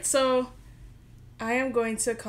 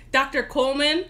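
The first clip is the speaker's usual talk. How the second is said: usual speaking voice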